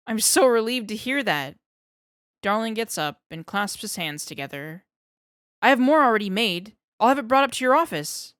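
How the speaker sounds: can't contain his relief; relieved excitement. Acted.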